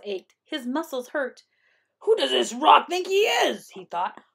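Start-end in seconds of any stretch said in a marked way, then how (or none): 2.0-3.7 high, loud outrage; indignant Coyote. A narrator's reading. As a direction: voice Coyote's offended disbelief. Indignant Coyote thinks aloud.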